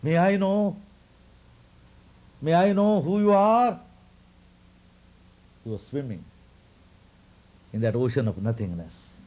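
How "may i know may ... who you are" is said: raised, pleading question in a higher voice; voicing a sage who asks who the child is; Markandeya asking. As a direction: ask timidly up high like a wary sage. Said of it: "therefore he asked".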